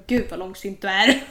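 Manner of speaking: loud, laughing complaint; amused, hammed-up impatience; mock exasperation. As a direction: tease a sulking partner through laughter. Acts out exasperation at brooding partner.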